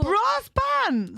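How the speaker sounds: high, amazed outburst; astonished echo of the words; incredulous question. Real, not acted. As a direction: question it in comic disbelief. Astonished question about bands.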